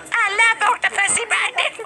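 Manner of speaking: lightly voiced anime girl; squeaky character voice. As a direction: coo it sweetly. Voicing a character.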